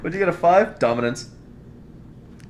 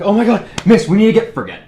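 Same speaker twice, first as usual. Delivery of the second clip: mock panic; 5 semitones higher, 8 dB louder than usual. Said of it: "in a pansy, almost panicked".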